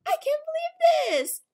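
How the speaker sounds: high, slow squeal of disbelief; stretched, high-pitched thrilled squeal; excited squeal. Real, not acted. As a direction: squeal it out, overjoyed. Squealing over a new pet.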